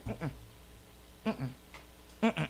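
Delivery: usual speaking voice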